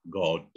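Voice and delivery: God with a rounded British o; British pronunciation. Shown as a demonstration. Teacher shows British is clearer, British God. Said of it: "kalau British lebih jelas".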